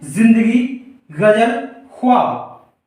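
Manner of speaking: जिंदगी गजल ख्वाब with z, gh-z and kh; Urdu nuqta sounds. Shown as a demonstration. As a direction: give each word its Urdu buzz. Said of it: "इसका उच्चारण क्या होगा उर्दू भाषा के". (उर्दू उच्चारण, नुक्ता की झनकार के साथ)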